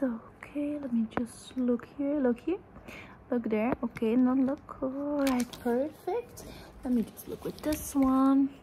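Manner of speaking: ordinary talk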